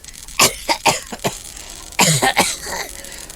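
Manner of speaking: long rough hacking cough; harsh cough. Acted. Injured friend coughs before reassuring the others, harsh cough. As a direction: cough hard, clearing a hurt chest.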